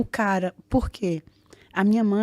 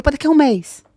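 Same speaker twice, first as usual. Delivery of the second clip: eager loud answer; 6 semitones higher, 10 dB louder than usual. Retold or imitated. Telling the agent the return date.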